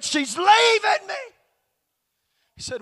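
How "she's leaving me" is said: high, loud imitated wail; bawling. Husband bawls in pastor's office, imitated loud wail. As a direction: cry it out loudly, imitating a distraught man. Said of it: "he was bawling in the pastor's office".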